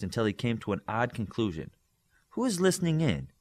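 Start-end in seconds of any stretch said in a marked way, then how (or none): 2.3-3.3 a higher, urgent call into the void; demanding to know who's listening, pitched up; loud thought. A narrator's reading. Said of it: "he thought very loud".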